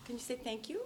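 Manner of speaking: soft coaxing. Acted. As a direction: murmur it to a toddler. Aunt coaxes baby softly.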